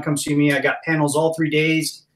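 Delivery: normal talking voice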